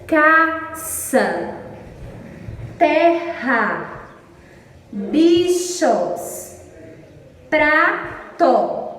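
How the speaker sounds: "caça, terra, bichos, prato" as ca-ça, ter-ra, bi-chos, pra-to; split into two syllables. Shown as a demonstration. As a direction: break "caça, terra, bichos, prato" into two deliberate beats each, with gaps. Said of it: "Dissílabas são quando há duas sílabas".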